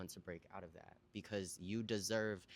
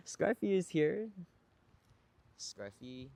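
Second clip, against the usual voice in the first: high sing-song dog talk; cooing to pet; 8 semitones higher, 6 dB louder, slower than usual. Real, not acted. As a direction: gush over the puppy.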